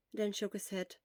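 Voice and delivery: usual speaking voice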